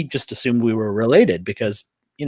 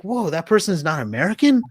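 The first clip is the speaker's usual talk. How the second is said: higher-pitched, mock disbelief; retold astonishment; 6 semitones higher than usual. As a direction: voice someone whose mind is blown. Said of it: "it blows people's minds".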